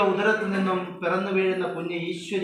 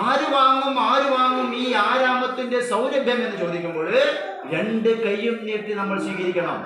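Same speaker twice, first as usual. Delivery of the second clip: slow, loud, higher preaching; impassioned appeal; 5 semitones higher, 5 dB louder, slower than usual. Acted. Speaker urging parents to embrace the child, loud slow preaching. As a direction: preach it to the hall with full conviction.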